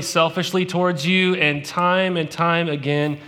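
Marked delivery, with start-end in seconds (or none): none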